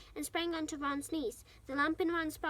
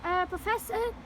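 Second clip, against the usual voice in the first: higher, louder tentative question; cautious call; 6 semitones higher, 6 dB louder than usual. A narrator's reading. Hermione tries to wake the sleeping professor, cautious. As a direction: call to him carefully, pitched up. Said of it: "approached Professor Lupin cautiously".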